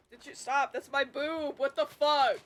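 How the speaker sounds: loud, startled protest; real shock rising to a loud what the fuck; startled outburst. Real, not acted. Streamer startled as her cat climbs on her, loud protest.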